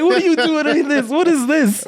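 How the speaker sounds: very high, exaggerated squeal of disbelief; mock disbelief. Host mimicking reaction in a viral TikTok, high mock disbelief. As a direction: squeak it out in hammed-up bewilderment.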